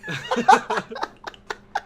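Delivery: squealing laugh at his own story; cracking up high and hard; helpless high-pitched laugh; high burst of laughter. Real, not acted.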